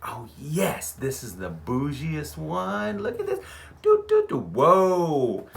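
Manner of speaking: gushing, going way up high on the do-do-do and whoa; giddy self-mockery in a high squeal; excited. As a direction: marvel at the fanciest hotel you've ever seen. Gloating over a bougie hotel listing.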